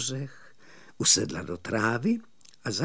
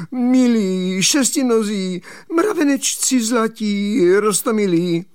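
pleading; 9 semitones higher, 5 dB louder than usual. Acted. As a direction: wheedle and implore, calling out high. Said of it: "volal žadonivým hlasem". (žadonivé volání vysokým, protáhlým hlasem)

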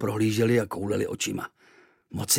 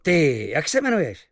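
high, loud impressed sailor; admiring sailor; 10 semitones higher, 4 dB louder than usual. Acted. Sailors admiring Kvíkvék's archery. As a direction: marvel at the shot. Obdivně zašumělo.

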